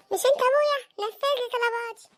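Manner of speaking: high-pitched bratty demand; demanding child. Acted. Child demands father meet every wish, bratty. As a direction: insist like a spoiled kid.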